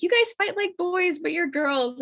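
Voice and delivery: high-pitched imitation of a teasing mother; teasing mom. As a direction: poke fun in a put-on high mom voice. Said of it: "my mom always made fun of us".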